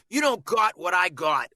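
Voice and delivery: mock Boston accent with a drawn open "got"; mistaken Boston vowel. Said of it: "a lot of people go".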